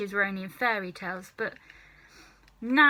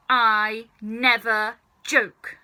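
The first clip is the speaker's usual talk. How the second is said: slow, louder, deadly serious; stern; 7 dB louder, slower than usual. Acted. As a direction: flatly insist. Grandma insists.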